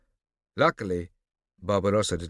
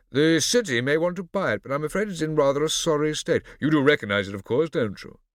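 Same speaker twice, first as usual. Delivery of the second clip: condescending; 8 semitones higher, 7 dB louder than usual. Acted. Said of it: "condescending tone".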